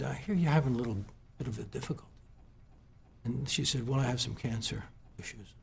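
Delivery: very soft, voice sinking with emotion; choked up. Real, not acted. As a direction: fight back real tears, barely getting it out. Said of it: "see, I'm getting emotional".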